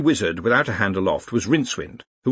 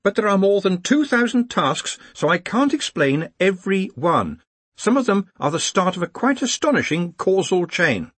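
high, insistent explanation; high-pitched academic explaining; earnest young organiser; 10 semitones higher, 4 dB louder than usual. Acted. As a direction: explain urgently in a raised pitch. Ponder explains over clamour.

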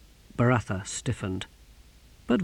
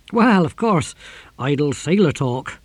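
loud, raised-pitch dismissal; hasty backtracking captain; 3 semitones higher, 5 dB louder than usual. A narrator's reading. Captain retracting his words before the Exquisitor, loud and hasty. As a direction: brush it off too loudly.

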